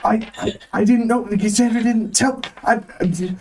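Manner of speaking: high-pitched flustered store manager; high nervous manager voice. Acted. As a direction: play a jittery, high-voiced boss. Nervous manager questioned by cop.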